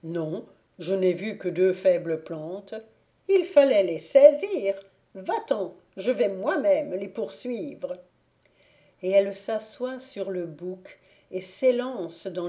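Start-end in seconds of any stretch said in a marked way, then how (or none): 0.0-2.7 squire's voice. Acted. Squire reports again. 3.3-8.0 loud, higher angry fairy; angry fairy taking matters into her hands; furious scolding. Acted. Fairy rages, goes herself.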